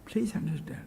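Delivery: breathy whisper, barely voiced; hushed plea; whispered aside. Real, not acted. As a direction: drop to a whisper and lean in. Aside after a long pause.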